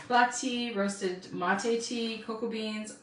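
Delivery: usual speaking voice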